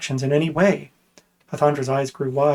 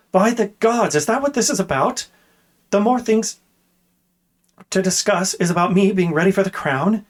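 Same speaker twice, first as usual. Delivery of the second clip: much higher, in outraged disbelief; indignant outburst; 6 semitones higher than usual. A narrator's reading. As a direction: burst out high, unable to believe it. Outraged princess bursts out.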